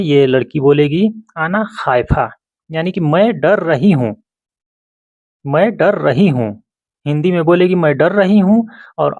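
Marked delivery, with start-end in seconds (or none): none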